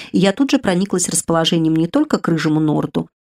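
ordinary delivery

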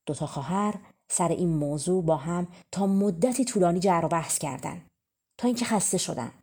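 ordinary delivery, nothing marked

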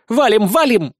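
high, loud call to flee; urgent exclamation. A narrator's reading. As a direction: yell to get everyone running. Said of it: "воскликнула Безос". (громко призывая бежать)

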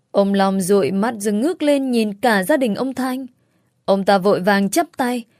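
plain reading voice

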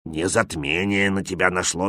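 lightly voiced wise old man. A narrator's reading. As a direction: explain calmly as a village elder. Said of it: "сказал мудрый старик Степан".

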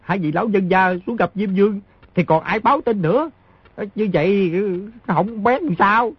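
high, mock-crying complaint; fake crying. Boy mock-cries after the smugglers are killed, teasing fake crying. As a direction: whine high in pretend distress. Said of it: "Đứa nhỏ khóc nói".

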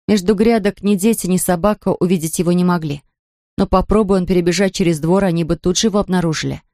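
ordinary delivery, nothing marked

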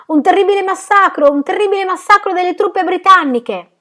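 full-throated shout, pitched very high; terrible newsboy cry. A narrator's reading. Newsboy announcing a massacre to the street, terrible loud cry. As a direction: cry the headline out across the street. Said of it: "veniva diffuso con voce tremenda".

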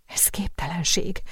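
breathy whisper, capped with a small rise on képtelenség; whispered disbelief. A narrator's reading. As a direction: breathe the words out, too stunned to speak aloud. Sophie repeats it in disbelief.

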